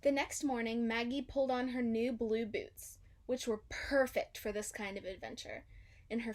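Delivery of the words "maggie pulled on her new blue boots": plain reading voice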